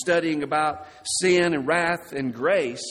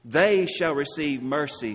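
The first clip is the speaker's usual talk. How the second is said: They hit harder than the rest of the line; stress on they. Shown as a demonstration. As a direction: lean on They, then let mercy fall. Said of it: "the emphasis is placed on they".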